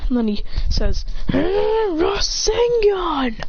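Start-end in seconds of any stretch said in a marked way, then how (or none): 1.3-3.4 high, long-drawn battle cry; mock anime scream; attack call. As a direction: wind up, then stretch the move name high. Character calls out attack.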